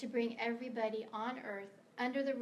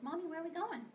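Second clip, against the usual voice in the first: put-on high little-girl voice; daughter's sleepy question in a child's high voice; four-year-old daughter; 6 semitones higher than usual. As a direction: imitate a small child asking innocently. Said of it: "she goes".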